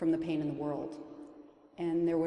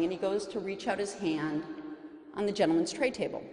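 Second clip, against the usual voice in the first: usual speaking voice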